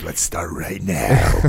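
slow, low, drawn-out command with a laugh in it; imitated sergeant major. Sergeant major telling the private to start now, slow and low. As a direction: hold each word, low and amused.

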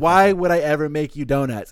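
loud, put-out retort; indignant refusal. Retold or imitated. Retelling Grimmy refusing Lil Toto's request, loud and indignant. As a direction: scoff at the very idea.

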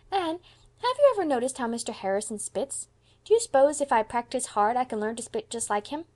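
put-on high, eager, curious child; little boy Davy. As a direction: ask excitedly like a small boy.

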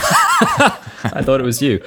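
slow, high, laughing protest. Real, not acted. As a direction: push back while laughing. Laughing retort.

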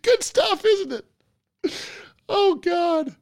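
high, gleeful squeal of delight. Real, not acted. Host gushing over the funny clip to his guest, in a squeaky high voice.

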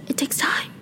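trailing into a whisper. Real, not acted. Vlogger tiredly explaining podcast prep, whispering. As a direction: drop to a breathy whisper.

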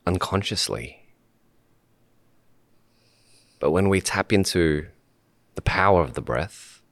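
calm meditation leader, long pauses between short phrases; unhurried guidance, letting each phrase settle in silence; guided meditation. Real, not acted. Host guiding podcast guests to sit and breathe, calm meditation voice.

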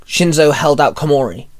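usual speaking voice

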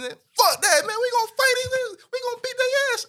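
high, loud, fired-up voice; hyped drunk friend. Retold or imitated. Drunk friend eager to fight.

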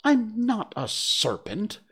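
slow, high-pitched indignant denial; high, offended little-girl protest. A narrator's reading. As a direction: protest it, drawn out and affronted. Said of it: "said Alice indignantly".

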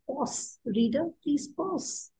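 hushed, slowed plea to a reader; soft request. Acted. Ellipsis asking the reader to pause, hushed and slow. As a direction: ask it softly, taking your time. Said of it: "a ellipsis, which says".

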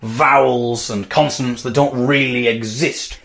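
ordinary delivery

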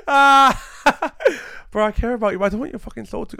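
laughing through the words, high; cracking up mid-sentence. Real, not acted. Laughing mock confession.

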